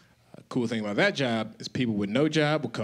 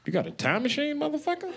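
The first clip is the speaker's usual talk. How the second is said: incredulous retort; 13 semitones higher than usual. Retold or imitated. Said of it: "I was like".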